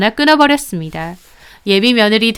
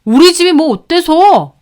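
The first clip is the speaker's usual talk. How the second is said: higher, louder defensive snap; offended outburst; 6 semitones higher, 5 dB louder than usual. Acted. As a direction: bristle at the insult. Boyfriend offended. (기분 상한 남친의 반발)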